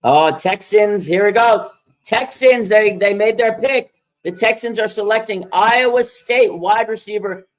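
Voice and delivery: loud, hyped call as the Texans pick comes in; excited. Real, not acted. Next pick arrives live.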